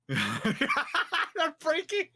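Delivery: high squeaky laugh through words; laughing it out slowly. Real, not acted.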